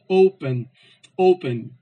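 "open" with a loud first syllable; "open" said twice, O strong, then falling; stress on O. Shown as a demonstration. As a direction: punch the O each time in "open". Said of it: "ele é mais forte".

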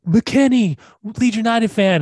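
high-pitched, badly faked British fan; mock a British fan calling out; bad British accent. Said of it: "like, they're British".

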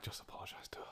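unobtrusive whispered advice; whispered aside. Retold or imitated. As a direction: whisper it as a discreet tip to a colleague. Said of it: "he pulled me aside just silently".

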